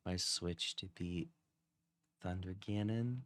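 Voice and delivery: slow, soft seductive voice; sultry voice. Pretend. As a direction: purr it slowly and softly. Acting out a spicy audio.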